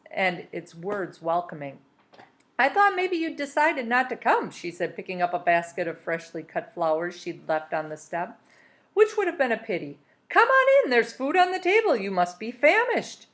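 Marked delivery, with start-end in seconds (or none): 2.6-4.5 a hint of a melodious old lady; higher, lightly sung welcome; lightly voiced old woman. A narrator's reading. Missus Griffin welcomes the boy, lightly melodious. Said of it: "Her voice, however, was melodious". 8.9-13.3 put-on high, sing-song welcoming old lady; melodious old woman. A narrator's reading. Missus Griffin invites Harvey to eat, sing-song and welcoming. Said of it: "Her voice, however, was melodious".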